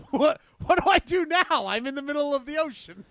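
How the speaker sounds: high, loud panicked cry. Retold or imitated. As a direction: yelp it out in sudden panic. Retold panic, boat gone.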